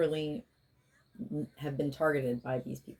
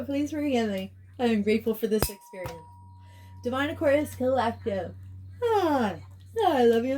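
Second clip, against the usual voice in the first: higher, sing-song chanted invocation; healing invocation; 5 semitones higher, faster than usual. Real, not acted. Healer invoking release for the Divine Aquarius Collective, chanted. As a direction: intone it like a ritual prayer.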